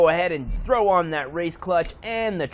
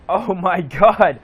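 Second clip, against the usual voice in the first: laughing; 6 dB louder than usual. Real, not acted. Laughs at huge spoilers.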